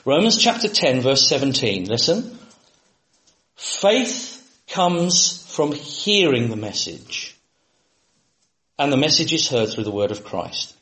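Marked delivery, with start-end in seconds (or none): 3.6-10.8 slow scripture reading. Acted. Reads Bible verse slowly.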